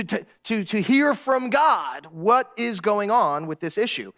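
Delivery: ordinary talk, nothing marked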